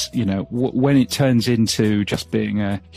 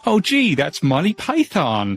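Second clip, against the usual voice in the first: higher, mock-delighted exclamation from an impressed listener; mock listener reaction; 6 semitones higher than usual. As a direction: play a fan hearing an English accent, a touch exaggerated. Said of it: "everybody goes".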